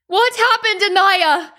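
high, loud demanding yell; frantic yell. A narrator's reading. Mother shakes her daughter demanding answers, frantic yell. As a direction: yell the question, pitch way up. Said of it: "she yelled".

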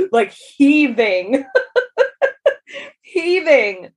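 laughing as she says "heaving", with "heaving" stretched slow; laughing through it. Real, not acted. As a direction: lets a laugh break up the word "heaving". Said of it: "that heave".

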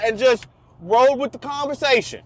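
ordinary talk, nothing marked

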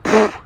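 mock "pfft" of disbelief; dismissive scoff. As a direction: blow it off with a puff.

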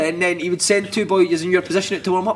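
ordinary talk

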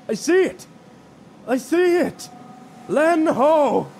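excited call from high up, very high pitch; shouting from crow's nest. Acted. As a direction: yell down from the mast. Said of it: "the excited shouts of a crewmate".

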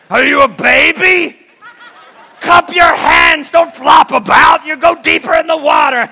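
loud, slow, high harsh yelling; mock scolding shout. Pastor imagines scolding a drowning girl from the edge, loud and harsh. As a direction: bark orders at a drowning child, mock-cruel.

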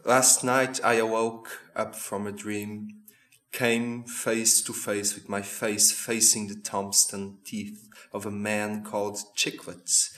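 poem recitation. Acted. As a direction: read it as spoken-word poetry. Recites [name] lyrics.

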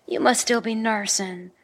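ordinary talk

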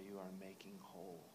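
whispering the prayer; whispered prayer. Acted. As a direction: breathe the words like a prayer.

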